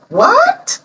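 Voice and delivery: high, shocked retold "What?"; stunned what. To the caller with the news, a retold shocked high cry. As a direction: let the disbelief jump up in pitch. Retelling hearing of her nomination.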